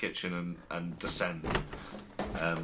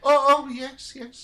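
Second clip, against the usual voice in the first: put-on high, thin old lady voice; fussy elderly landlady showing rooms; very old woman; 8 dB louder, slower than usual. [name] answers the guest.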